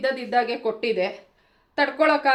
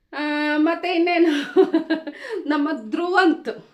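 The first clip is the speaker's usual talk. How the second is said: laughing, louder and higher as she names him; 4 semitones higher, 4 dB louder than usual. Real, not acted. Vlogger laughing as she brings up Dhruvanth. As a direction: chuckle through the name in amusement.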